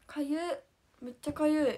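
itchy complaint. Real, not acted. Streamer bitten by mosquitoes, loud whining complaint. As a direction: cry out in real annoyance at the itch.

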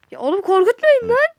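high and loud, shaken protest; frightened outburst; startled shout. Real, not acted. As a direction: yell after being startled. Jump scare in game.

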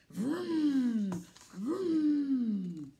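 loud, drawn-out vroom vroom; engine vroom. Pretend. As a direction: rev it up twice like an engine. Truck revs into four-wheel.

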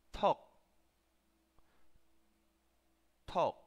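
"Talk" as Korean "teok" with a puff on the T; short, separate "Talk" with an airy T; aspirated T. Shown as a demonstration. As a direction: burst the T of "Talk" open and stop the k short. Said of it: "T, así".

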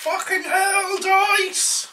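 loud exasperated curse; frustrated shout. Real, not acted. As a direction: yell at the dice in frustration. Bad dice roll outburst.

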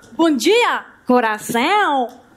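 "tout bien, bon dia, coração" said slowly the Portuguese way; Portuguese pronunciation demo. Shown as a demonstration. Teacher showing the class Portuguese pronunciation, slow. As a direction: show the Portuguese sound, slowly. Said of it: "une prononciation différente".

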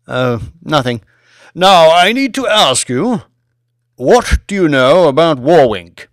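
high, loud, aged aristocrat's voice; a grand old lord leaning in to ask; creaky old lord, curious and commanding; old Lord Martin. A narrator's reading. Old lord voices his question.

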